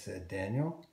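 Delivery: usual speaking voice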